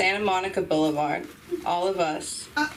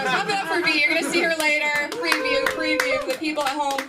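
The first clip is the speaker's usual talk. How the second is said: raised, lively emcee shout-out; hyping the crowd; 7 semitones higher, faster than usual. Real, not acted. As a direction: whip up applause for the next act.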